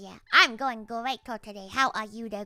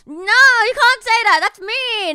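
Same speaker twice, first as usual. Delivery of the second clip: loud, high, slow mock protest; mock outrage; 10 semitones higher, 15 dB louder, slower than usual. Streamer protesting chat's praise, loud mock whine. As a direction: wail it like playful offense.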